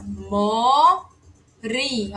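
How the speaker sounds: "Mori" stretched out, with a tapped R as in arara; syllable-by-syllable demonstration. Shown as a demonstration. Teacher shows students the R of arara, slow demonstration. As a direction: say "Mori" very slowly, vowels held. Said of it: "Ó o R de arara".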